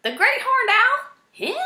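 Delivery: very high, loud disbelief; can't believe it; astonished. A narrator's reading. Erith astonished.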